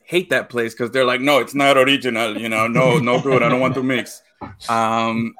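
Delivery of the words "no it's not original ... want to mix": lightly put-on Peruvian dad voice; a hint of a grumpy immigrant father; father-in-law impression. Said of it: "that's my impression of my father-in-law".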